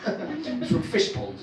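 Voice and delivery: ordinary talk, nothing marked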